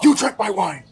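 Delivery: mock outrage, pitch jumping up; retold angry accusation. Pointing diner confronts the sommelier, retold as a high, angry accusation. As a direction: imitate an incensed customer accusing someone. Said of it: "He's like, goes".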